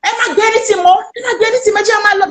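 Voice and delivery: high, frantic retold outburst; reenacted panicky cry; like a madman. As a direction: pitch it way up, repeating in mock panic. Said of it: "like a mad man".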